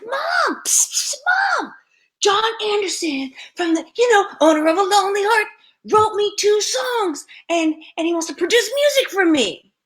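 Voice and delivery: high, urgent, excited gush; thrilled news-breaking. Retold or imitated. Daughter telling mom about songs written for her, thrilled.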